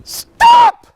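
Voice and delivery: very high, loud yell; full-voice demo shout; shouted stop. Shown as a demonstration. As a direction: yell it as loud as you can. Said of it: "like this".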